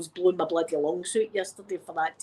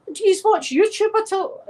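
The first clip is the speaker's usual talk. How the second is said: mock quote; 11 semitones higher, 5 dB louder than usual. Retold quote to ambulance crew.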